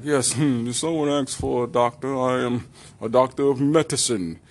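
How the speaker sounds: a lightly put-on posh British doctor; pompous British doctor; mock British accent. British doctor answering the call, light British accent. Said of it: "British accent".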